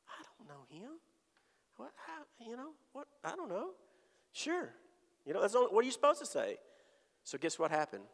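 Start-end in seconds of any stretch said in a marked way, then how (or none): 0.1-4.7 high, baffled stammer; flustered disbelief. Retold or imitated. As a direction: sputter in confused surprise. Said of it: "And I was like".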